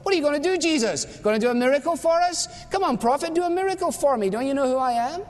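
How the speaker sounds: high, taunting challenge to the prophet; mocking demand. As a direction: sneer it up high, goading him. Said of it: "he wanted a little miracle".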